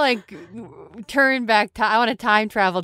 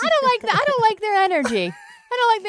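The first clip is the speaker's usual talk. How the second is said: whiny complaint; 11 semitones higher, much faster than usual. Acted. Character whining.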